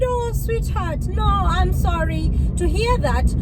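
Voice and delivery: acted-out soothing "poor thing" tone; retold sympathy. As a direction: gently console someone confused, drawing out the words. Consoling a confused patient, retold.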